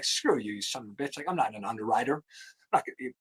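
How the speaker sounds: retold angry muttering. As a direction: grumble the insult under your breath, like he can't say it to the boss's face. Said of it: "And I was pissed".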